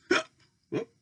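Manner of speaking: high squeaky mock hiccup; hiccup squeak. Playful hiccup sound.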